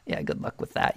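high cackling taunt that drops to a breathy sneer; mocking laugh. Villain Moff Gideon gloating at Bo-Katan, mocking laugh. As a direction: gloat at the loser. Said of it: "kinda going around like".

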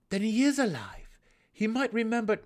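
loud, high rush of hope; excited outburst. A narrator's reading. Drizzt bursts out hopefully at the gnome, loud. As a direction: burst out eagerly.

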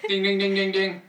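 loud, high "ding ding ding" like a timer; mock timer ding. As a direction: imitate an alarm going off, high and insistent. Imitates the egg timer going off.

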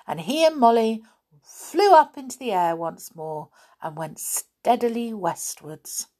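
ordinary delivery

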